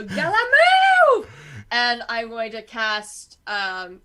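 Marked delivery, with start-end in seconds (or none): 0.0-1.3 raised, urgent in-character yell. Acted. Player yelling at an ally to get clear, urgent shout. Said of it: "I'm just gonna yell".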